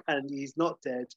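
normal talking voice

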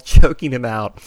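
normal talking voice

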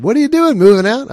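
imitating a joking neighbor, very high and loud; high, hollered mock question. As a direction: tease it in a loud, high-pitched voice. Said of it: "she jokingly said".

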